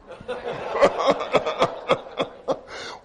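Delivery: a high, airy burst of laughter; hearty laugh. Real, not acted. Laughs at his own aside.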